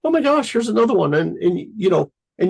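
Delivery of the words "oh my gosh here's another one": quick, higher "oh my gosh"; excited gush. Retold or imitated. Ideas keep coming and he greets each one, fast and higher. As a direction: blurt it out as another idea lands. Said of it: "you're like".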